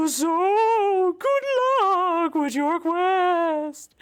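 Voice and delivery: a giggly spirit reading her email; ghostly voice. Acted. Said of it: "not the voice I had for that character".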